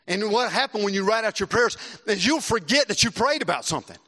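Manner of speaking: ordinary talk, nothing marked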